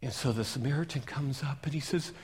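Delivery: usual speaking voice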